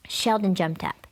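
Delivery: ordinary delivery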